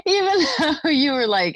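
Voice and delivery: high-pitched, barely holding in the laugh. Real, not acted. Host recapping her friend's furious year, laughing. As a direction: laugh your way through the words.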